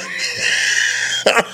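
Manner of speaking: breathless, voiceless wheeze of laughter; wheezing laugh. Real, not acted. Host loses it over the paper towel joke, wheezing laugh. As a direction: laugh till no voice comes out.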